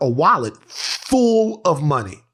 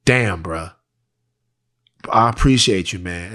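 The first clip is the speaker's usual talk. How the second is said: imitating a grateful neighbor, lower; 7 semitones lower than usual. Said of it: "He was like".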